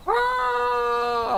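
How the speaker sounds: sudden high-pitched yelp; high shout. Acted. Calling to chat with a high yelp.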